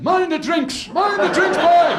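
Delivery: a fella at the back yelling to save the drinks; shouting. Acted. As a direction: bellow over the brawl about the drinks. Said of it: "a fella at the back of the fight shouting".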